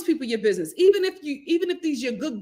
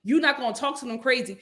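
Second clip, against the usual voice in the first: ordinary talk, nothing marked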